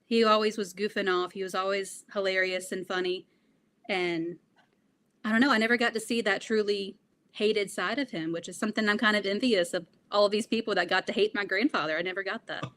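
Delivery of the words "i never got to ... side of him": ordinary talk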